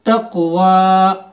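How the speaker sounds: तक़वा very slow, क़ joined onto व; drawn-out demo of तक़वा; slow tutor recitation; jazm blending. Shown as a demonstration. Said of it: "पिछले वाले हर्फ को join करना".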